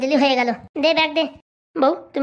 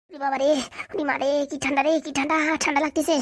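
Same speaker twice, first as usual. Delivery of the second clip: soft, feeble moaning from cold; shivering with fever; 5 dB quieter than usual. Acted. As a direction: moan through feverish chills. Feverish man moans with cold.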